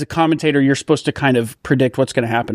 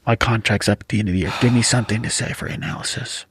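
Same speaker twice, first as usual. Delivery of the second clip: hushed plea sinking into a whisper; urgent whisper; 3 semitones lower than usual. Acted. Commentator needs analysis from Rex before the snap, urgent whisper. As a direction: beg your co-host off mic, ending fully whispered. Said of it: "Keep whispering to it now".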